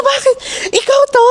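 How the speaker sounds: high, surprised questions; surprised mother. Retold or imitated. Mother abroad discovering her viral daughter, surprised and high. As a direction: ask like a shocked mom abroad. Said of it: "mother surprised on the phone".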